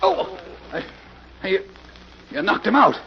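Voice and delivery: breathless, stammering shock, then a high cry; terrified as his partner drops. Acted. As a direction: gasp and stumble over the words. Partner knocked out before him.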